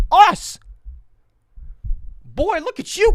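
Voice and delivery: very high, loud mock outrage; incredulous comeback. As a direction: squawk it back at your buddy in disbelief. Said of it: "I was like".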